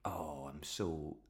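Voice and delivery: slow, drawn-out mock lament; mock music-hater. Imagining someone who dislikes music, hammed-up slow complaint. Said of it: "there's nobody that's like".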